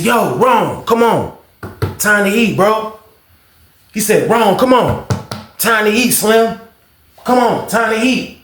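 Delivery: calling Ron to the table. Retold or imitated. Cook calling Ron to eat.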